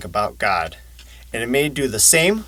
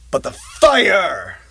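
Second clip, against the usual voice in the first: echoing fire; 6 semitones higher, 3 dB louder, slower than usual. Acted. Dramatic emphasis on fire.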